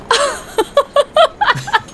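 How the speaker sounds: a high, happy burst of laughter; high delighted laugh. Acted. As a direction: laugh in relief at the mix-up. Laughing at his old mistake.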